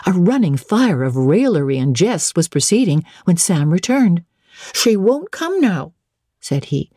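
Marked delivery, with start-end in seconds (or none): 4.7-5.9 high, slow servant voice; drawn-out higher-pitched servant; footman reporting. A narrator's reading.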